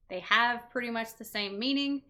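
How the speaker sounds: ordinary delivery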